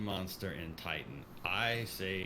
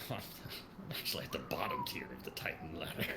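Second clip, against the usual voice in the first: laughing whisper. Real, not acted.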